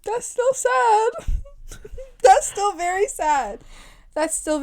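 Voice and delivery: high, slow mock crying; fake-sob the words; hammed-up whimper. Friend mock-cries over her co-host's childhood, high and slow. Exaggerated pity, laughing.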